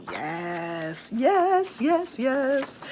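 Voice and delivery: rising giddy chant of yeses; excited sing-song yes. Real, not acted.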